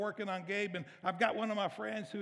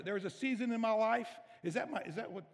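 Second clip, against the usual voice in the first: ordinary talk, nothing marked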